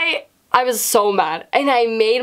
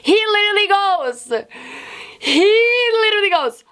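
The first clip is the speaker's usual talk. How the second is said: incredulous; 10 semitones higher than usual. Real, not acted. Storyteller reaching the unbelievable twist, incredulous. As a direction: squeal the build-up in disbelief.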